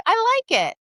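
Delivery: a playful, stubborn reply; defiant quote. Retold or imitated. Friend defending her outfit.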